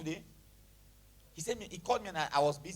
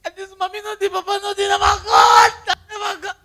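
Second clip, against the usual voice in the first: high, loud hammed-up lament; imitation pity-seeker, rising to a shout; mock self-pity; 8 semitones higher, 14 dB louder than usual. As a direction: wail it out as a put-on hard-luck story. Mimics self-pitying testimony, then rejects it.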